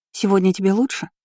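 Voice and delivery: tentative, rising and a touch apprehensive; wary. Acted. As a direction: ask gingerly, unsure of the reaction. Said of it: "спросила с опаской".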